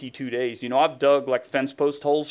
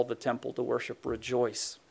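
dropping much softer into a hushed "Rejoice"; 3 semitones lower, 8 dB quieter than usual. Acted. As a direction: let God's words fade to a near hush. God's voice fades to hush.

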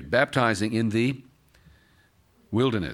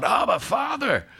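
much higher, slow cry of Abba Father; 12 semitones higher than usual. Acted. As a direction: call out high like a child to a father. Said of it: "the Spirit of God inside of us cries out".

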